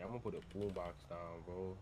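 ordinary talk

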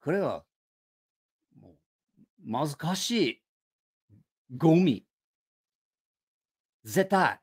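日本語で軽蔑して; Japanese father-in-law. Retold or imitated. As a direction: spit out a verdict of garbage. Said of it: "And he was like".

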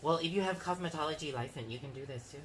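normal talking voice

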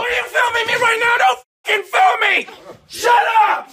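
angry outburst. Pretend. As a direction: snap and yell at the camera. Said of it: "Best impression of a girl on a period. Go".

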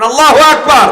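high, loud takbir shout. Shown as a demonstration. Preacher prompts the crowd with a loud takbir. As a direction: call the takbir out with full force. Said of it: "বলেন".

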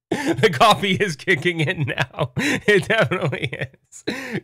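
slow, high words through laughter; giggly. Real, not acted. Streamer noticing the coffee kick in, giggling.

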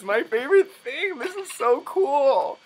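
high, loud fan squeal; overdoing the excitement. Real, not acted. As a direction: let it burst out, pitch way up. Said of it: "You're overdoing it".

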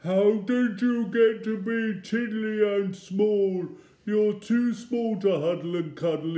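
high, very loud wail; full-voiced storybook bawl. A narrator's reading. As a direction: bawl it out like a big upset creature. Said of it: "hear the voice bawl".

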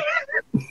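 high, soft laugh breaking out; high soft burst of laughter. Real, not acted. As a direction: let a laugh escape. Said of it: "That's brilliant".

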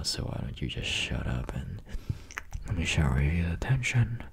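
put-on breathy close-mic whisper to a girlfriend; teasing boyfriend whisper, close and intimate. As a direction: whisper it right into her ear. Back into the whispered roleplay.

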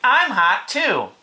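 high, loud whine; boy's cry. A narrator's reading. Boy begging for water, high cry. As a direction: complain loudly in a high voice. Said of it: "cried Avery".

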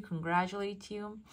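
normal talking voice

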